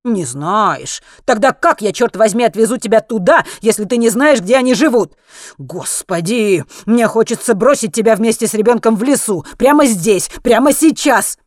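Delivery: furious, mounting exasperation; exasperated rant. A narrator's reading. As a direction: rant at the passenger, fed up. Exasperated driver rants at the woman.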